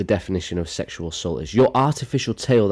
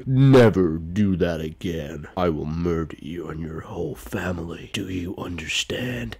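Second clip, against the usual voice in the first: Thanos. Acted. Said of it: "sounds like Thanos".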